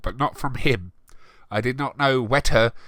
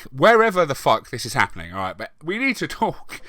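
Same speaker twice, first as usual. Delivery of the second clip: usual speaking voice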